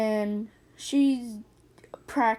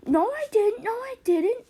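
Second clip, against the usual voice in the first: high whiny denial; 10 semitones higher, 4 dB louder than usual. Retold or imitated. Boy denying he took brick.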